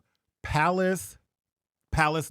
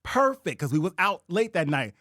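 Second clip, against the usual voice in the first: ordinary talk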